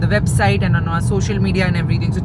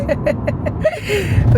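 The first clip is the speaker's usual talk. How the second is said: high laugh; 12 semitones higher than usual. Real, not acted. Laughing at uncle's hi.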